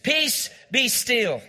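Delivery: slow, deliberate rebuke of the storm; calm, drawn-out command. Shown as a demonstration. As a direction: speak peace slowly, word by word. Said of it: "Say it".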